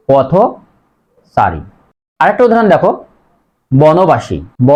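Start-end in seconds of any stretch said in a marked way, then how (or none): 0.1-1.6 পথচারী drawn out very slowly, o sound after থ; slow model word. Shown as a demonstration. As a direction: stretch পথচারী for the class. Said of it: "মাঝে ওকার হয়েছে". (পথচারী খুব ধীরে টেনে বলা)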